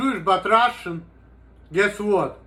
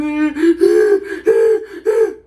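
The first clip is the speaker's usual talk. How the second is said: loud high laugh; 11 semitones higher, 6 dB louder than usual. Real, not acted. Cracking up after mocking his critics' portrayal, loud high laugh.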